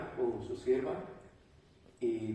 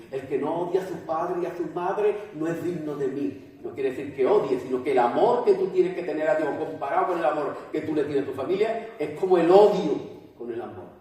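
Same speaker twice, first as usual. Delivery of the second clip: louder, rising preacher's voice; full-force preaching; 3 semitones higher, 8 dB louder than usual. Acted. Preacher explains loving God above family, full-force preaching. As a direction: preach it out to the congregation.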